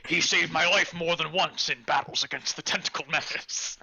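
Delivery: fond, boastful NPC voice; proud old warrior voice. Acted. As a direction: reminisce like a battle veteran.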